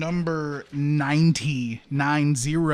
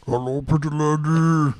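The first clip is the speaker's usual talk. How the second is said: Andre the Giant impression; 4 dB louder than usual. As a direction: rumble it like a gentle giant. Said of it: "Do you have an Andre the Giant impression".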